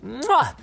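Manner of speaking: kiss the air in appreciation; chef's kiss. Shown as a demonstration. Praising the machines with kiss noise.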